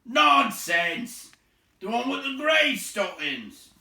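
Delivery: loud, high troll objection; contradicting troll. A narrator's reading. Voice contradicting Bert, loud and high. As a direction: snap back loudly. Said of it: "said a voice like William's".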